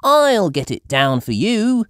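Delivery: high, loud and eager; Peppa's voice. A narrator's reading. Peppa offering to fetch George's hat, eager and high. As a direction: offer to climb bravely. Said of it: "says Peppa".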